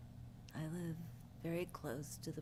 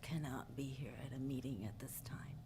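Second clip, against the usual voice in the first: lost voice; 3 semitones lower than usual. Real, not acted. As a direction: voice dropping into a hoarse whisper. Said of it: "I have no voice".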